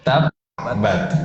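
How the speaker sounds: "Tab, bat" with a dead stop after Tab; cut-off b. Shown as a demonstration. Teacher shows what it should be, cut-off b. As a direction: stop "Tab" short on closed lips, then release bat.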